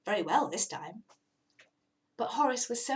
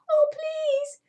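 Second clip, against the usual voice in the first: much higher, louder begging kitten; pleading cat; 7 dB louder than usual. Acted. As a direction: beg to be rescued.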